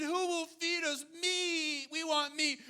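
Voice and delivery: preacher mimics Israelites begging Moses for meat, whining; mock whine. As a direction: whine it like a spoiled crowd. Preacher voices complaining Israelites.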